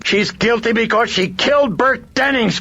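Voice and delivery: high, loud, desperate shriek. A narrator's reading. Chris shrieks at the priest that her daughter killed a man. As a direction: shriek it at him in despair. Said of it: "Chris shrieked at him".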